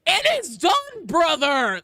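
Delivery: loud, high battle cry; booming mock-heroic shout; bellowing bot teammate. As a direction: shout it like a triumphant space warrior. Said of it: "they'll just go, like, stomp up to it".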